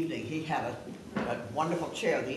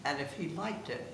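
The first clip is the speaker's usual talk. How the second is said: ordinary talk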